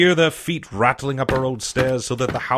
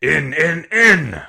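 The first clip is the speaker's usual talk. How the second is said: building shout, louder and higher on the last word; urgent shout; 3 semitones higher, 6 dB louder, much slower than usual. A narrator's reading. Blind man urging his men inside, building impatient shout. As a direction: shout the order, climbing with each repeat. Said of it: "he shouted".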